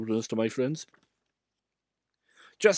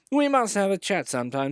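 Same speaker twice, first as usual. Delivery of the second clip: cheeky; 10 semitones higher, 4 dB louder than usual. A narrator's reading. Engine being cheeky.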